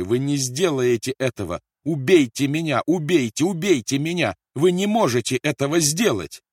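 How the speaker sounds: high, loud, frantic pleading; desperate scream. A narrator's reading. Captive mother begs to be killed instead, desperate scream. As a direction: beg with rising panic. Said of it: "закричала Кэт и забилась на стуле".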